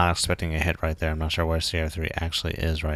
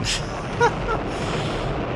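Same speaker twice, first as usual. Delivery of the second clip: high breathy laugh. Real, not acted. Wrong target attacked.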